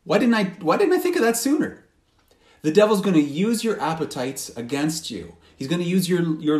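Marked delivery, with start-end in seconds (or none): 0.0-1.8 higher, quicker exasperated "why didn't I"; frustrated self-reproach. Retold or imitated. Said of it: "go like".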